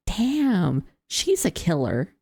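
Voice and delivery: normal talking voice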